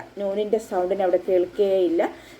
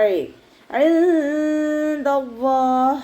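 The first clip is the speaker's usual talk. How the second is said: slow, higher chanted ഇൻദല്ലാഹ് with the nun hidden and nasal; correct recitation; 6 semitones higher, 3 dB louder, much slower than usual. Shown as a demonstration. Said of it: "നൂനിൻ്റെ സൗണ്ടിനെ hide ചെയ്യുകയും".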